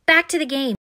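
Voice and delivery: rally a brother to keep going; pressing, insistent child; urgent. A narrator's reading. Urgent order amid monkey chaos.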